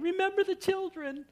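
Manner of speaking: high-pitched imitation of a screaming wife; hammed-up, high-pitched pleading; mock panicked plea. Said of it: "she's screaming at me".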